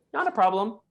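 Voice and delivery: sweetly gracious guest easing the producer's worry; gracious reply. Pretend. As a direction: reassure them kindly, no fuss. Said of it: "you graciously say".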